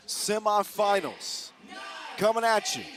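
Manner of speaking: announcer intro. Real, not acted. Commentator hyping the crowd at tip-off, booming announcer. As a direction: drag out Semifinals like a ring announcer.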